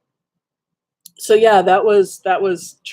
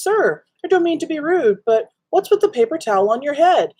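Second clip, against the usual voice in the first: polite server; 9 semitones higher than usual. Retold or imitated. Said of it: "looks at him and says".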